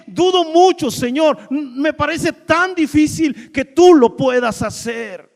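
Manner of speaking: building from earnest pleading to a loud, high cry; Abraham's anguished doubt. Retold or imitated. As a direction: pour out the doubt until it breaks into a shout. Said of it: "es como dijera".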